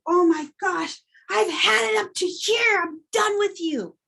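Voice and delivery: high, exasperated outburst at someone; fed-up yelling. Shown as a demonstration. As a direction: blow up like you've had it with them. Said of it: "'cause they're yelling".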